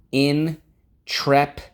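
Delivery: high, loud and slow, savouring "Intrepid"; dramatic stretched intrepid. Shown as a demonstration. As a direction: boom out "Intrepid" slowly like a grand title.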